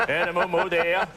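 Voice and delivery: put-on high Boston Kennedy accent singing a nursery rhyme; a Kennedy father reading his child a bedtime story, hammed-up Boston accent. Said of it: "your dad never read you a bedtime story like".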